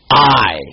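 a weighty, insistent "I" standing alone; emphatic I. Acted. Teacher repeating God's contrast for the class, emphatic I. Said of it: "in contradistinction to all others".

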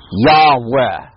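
"Yahweh" said YAH-weh, stress on the first syllable, ending on a clear "eh"; pronouncing the name. Shown as a demonstration. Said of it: "That's the way you pronounce the name".

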